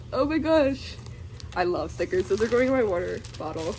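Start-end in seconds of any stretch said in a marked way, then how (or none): none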